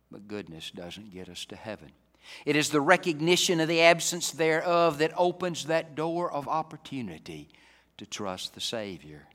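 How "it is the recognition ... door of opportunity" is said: loud, raised preaching voice; forceful preaching. Real, not acted. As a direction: drive the point at the congregation.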